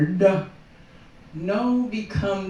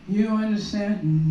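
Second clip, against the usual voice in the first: ordinary talk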